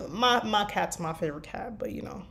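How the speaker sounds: normal talking voice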